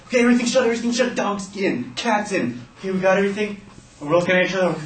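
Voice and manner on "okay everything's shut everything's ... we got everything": high, urgent acted check-off; frantic checklist. Kids sealing the house against bees, frantic. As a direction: rattle off a panicky checklist.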